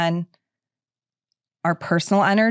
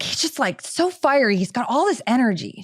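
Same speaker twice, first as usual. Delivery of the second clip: psychic voice pitched higher, eager; imitating excited psychic; 5 semitones higher than usual. Quoting the medium describing Steve, higher excited voice. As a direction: gush like the medium did. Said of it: "she was just like".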